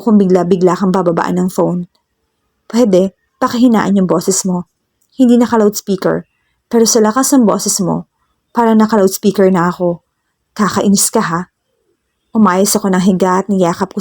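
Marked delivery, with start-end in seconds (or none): none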